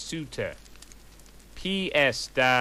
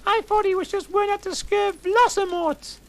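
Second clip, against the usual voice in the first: high squeaky voice; 4 dB louder, faster than usual. Acted. Continues the angry outburst.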